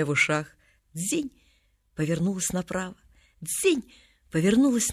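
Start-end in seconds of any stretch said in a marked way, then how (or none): 0.9-1.3 soft, high "dzin"; a small quiet chime, pitched up; tinkling earring. A narrator's reading. Girl turns at the mirror, soft high tinkle. 3.4-3.8 soft, high "dzin"; a small quiet chime, pitched up; tinkling earring. A narrator's reading. Girl turns at the mirror, soft high tinkle.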